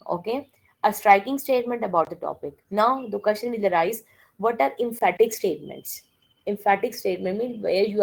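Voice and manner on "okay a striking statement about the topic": ordinary talk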